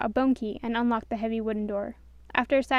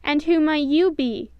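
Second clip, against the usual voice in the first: gruff, loud challenge, slowed; angry; 4 semitones higher, 7 dB louder, slower than usual. A narrator's reading. Grumpy confronting the stranger, angry and loud. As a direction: demand it with a bat in hand. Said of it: "Grumpy asked in an angry voice".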